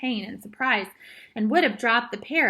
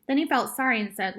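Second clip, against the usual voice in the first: plain reading voice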